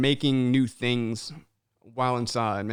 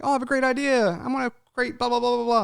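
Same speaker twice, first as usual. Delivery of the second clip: higher, mocking voice of someone all talk; mock big talker; 8 semitones higher, faster than usual. Host mocking people who have ideas but never act, higher mock voice. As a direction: scoff at dreamers who never follow through.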